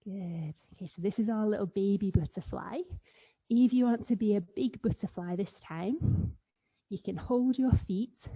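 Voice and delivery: ordinary talk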